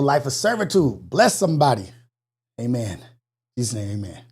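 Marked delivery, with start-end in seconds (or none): 2.6-4.2 hushed amen. Real, not acted. Closing a short prayer.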